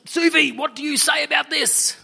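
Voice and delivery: loud, high, angry challenge; the wounded king snaps his question; angry demand. Acted. Angry injured king questions Sufi.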